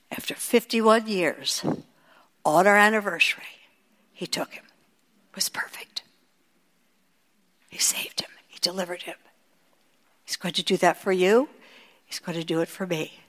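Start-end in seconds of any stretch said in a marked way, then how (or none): 4.1-9.2 hushed, voiceless and soft; whisper. Real, not acted. Widow whispering of her late husband, soft and voiceless. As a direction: breathe it out as a tender whisper.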